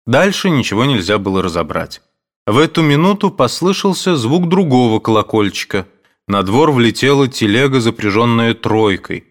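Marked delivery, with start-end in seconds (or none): none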